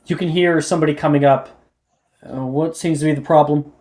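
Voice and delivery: ordinary talk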